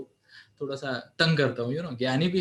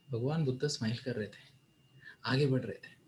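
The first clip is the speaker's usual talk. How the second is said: ordinary delivery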